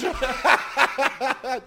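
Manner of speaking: high, breaking burst of laughter; real high-pitched laughing fit. Real, not acted. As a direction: laugh helplessly at the mishap. Cracking up over the photo.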